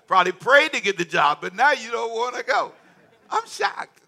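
high, loud teasing scold; hammed-up outrage, high and loud; mock scolding. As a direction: tease them like a shocked aunt. Mock scolding of lazy workers.

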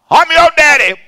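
high, loud, hammed-up father yell; mock dad shouting at his grown kid; mock angry father. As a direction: bellow it like an outraged dad. Parent yelling at grown child.

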